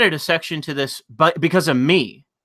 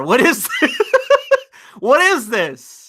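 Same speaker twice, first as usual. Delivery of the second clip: high, laughing disbelief; incredulous; 8 semitones higher, 3 dB louder than usual. Real, not acted. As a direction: ask in stunned disbelief. Disbelief at the performance.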